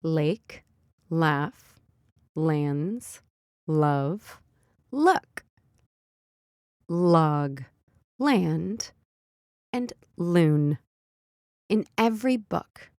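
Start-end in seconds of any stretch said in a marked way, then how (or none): 0.0-10.8 each word said slowly and separately; word list. Shown as a demonstration. Mom reviewing phonics words, one at a time. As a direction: read each word out for the child. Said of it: "These are the words we learned".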